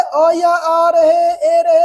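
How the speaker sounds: very slow, vowels drawn out; slow recitation. Shown as a demonstration. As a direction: recite the vowel list slowly to students. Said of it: "क्या हम लोग पढ़े हैं?". (विद्यार्थियों के लिए स्वर बहुत धीरे-धीरे खींचकर)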